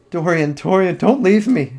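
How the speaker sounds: ordinary delivery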